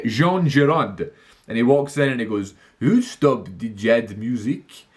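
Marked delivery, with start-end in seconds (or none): 2.8-4.8 slow, exaggerated French accent; a haughty Frenchman in a hammed-up French accent; absurd French accent. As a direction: drag out each word in a ridiculous French accent. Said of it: "the most absurd French accent".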